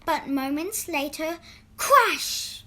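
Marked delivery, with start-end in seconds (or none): 1.8-2.6 loud, high, punchy crash; storyteller's loud sound-effect crash; dramatic crash. A narrator's reading. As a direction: burst out the word like the fall. Bag falls off the bicycle.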